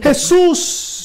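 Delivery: súplica. Retold or imitated. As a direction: call out to Jesus in prayer. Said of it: "que oró a Jesús". (súplica en voz algo alta)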